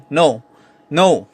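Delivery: NO cut short with a falling pitch; mock-angry "no", dropping down; angry falling intonation. Shown as a demonstration. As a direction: drop "no" firmly as in a demonstration of anger. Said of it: "Ito yung angry na intonation sa English".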